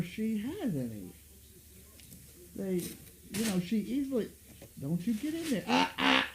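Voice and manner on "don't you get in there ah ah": sharp warning at the dog; scolding the dog. Real, not acted. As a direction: stop the dog cold. Scolding the dog near cookies.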